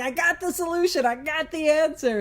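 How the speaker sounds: mildly hammed-up enthusiasm; excited Bill. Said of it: "Bill is all excited".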